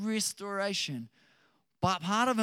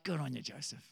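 low, softened aside in an imitated inner voice; sly approval; 3 semitones lower, 7 dB quieter, faster than usual. Our fallen nature cheering Joseph's spite, sly quiet approval. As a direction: quietly egg him on, as a mock aside. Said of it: "fallen nature in us is like".

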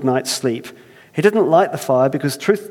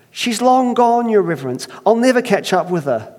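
higher, fretful Irish reply; pleading Irish; 8 semitones higher, 3 dB louder than usual. Acted. First man despairing to Earthmaker in Irish accent. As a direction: protest helplessly. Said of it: "I can't help but try it".

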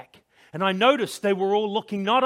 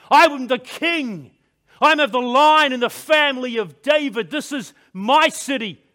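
indignant, raised voice of royal fury; pitched up and forceful, claiming what is yours; rising rage; 5 semitones higher, 6 dB louder than usual. A narrator's reading. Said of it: "this rage built up inside me".

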